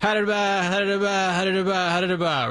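rolling nonsense auctioneer chant; auctioneer patter. Retold or imitated. Host imitating his friend's auction business, auctioneer patter. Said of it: "an auction business where he goes".

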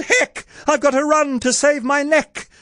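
very high, urgent squeal; Cindy's hammed-up shriek; panicked shout. A narrator's reading. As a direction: cry out in high-pitched alarm. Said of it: "She shouted".

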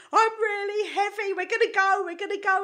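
high, loud, frantic pleading; panicked plea. Retold or imitated. To the tandem instructor at the plane door, panicking. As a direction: beg him not to jump yet.

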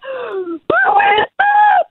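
sharp high gasp of fright; high frightened gasp, mostly breath; shocked toddler gasp. Retold or imitated. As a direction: gasp in sudden terror like a scared toddler. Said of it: "he was like".

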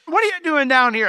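high, acted exasperated demand; scolding older brother. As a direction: imitate a bossy older brother. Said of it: "the older brother like me".

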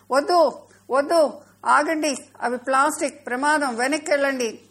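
high, loud warning cry; urgent calling back. A narrator's reading. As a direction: shout to stop them from danger. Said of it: "వెనక్కి పిలిచింది".

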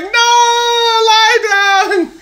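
very high, loud mock order; bossy, overfussing mother yelling; mock South American mom insisting; accented mom shout. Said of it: "She's like".

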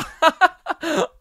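real high burst of laughter. Real, not acted. Cracking up at his own train story, high laugh.

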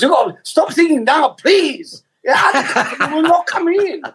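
high, pleading mock Chinese accent. Shopkeeper begging the customer to stop singing, high Chinese accent. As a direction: beg in frantic imitation of a Chinese-accented shopkeeper. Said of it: "doing my Chinese accent last week".

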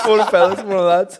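high, loud laughing echo; laughing mimic. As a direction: repeat it through laughter. Mimicking the morning message.

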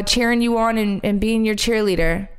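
ordinary talk

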